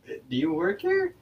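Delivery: high, careful question to a stranger. Retold or imitated. Asking the worker if she works here, voice pitched high. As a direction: pitch it way up, tentative.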